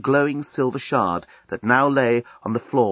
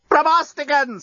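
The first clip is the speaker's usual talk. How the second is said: much higher, louder scream; 3 dB louder than usual. A narrator's reading. Scraliontis screaming for help while being strangled. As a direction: shriek the name in alarm. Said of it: "screamed Scraliontis".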